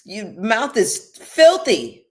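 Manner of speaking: high, loud scolding mom voice; mother scolding her cursing daughter, high and loud. Retold or imitated. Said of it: "I can hear her now".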